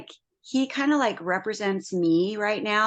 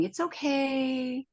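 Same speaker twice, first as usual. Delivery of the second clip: reassuring spirit; 4 semitones higher, 4 dB quieter than usual. Retold or imitated. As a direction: soothe her like a frightened animal. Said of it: "I have spirit going".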